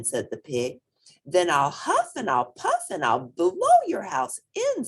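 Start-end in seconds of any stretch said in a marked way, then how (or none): 1.3-4.9 louder, higher wolf threat. A narrator's reading. Wolf threatening the straw house pig, louder. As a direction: bluster like a big bad wolf. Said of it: "said the wolf".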